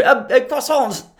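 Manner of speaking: high, slow mock French; mimicked French accent. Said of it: "the French accent is cute".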